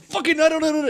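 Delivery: imitated blowup, pitch shooting high; mock exasperation. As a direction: crack into high-pitched frustration. Acts out partner exploding.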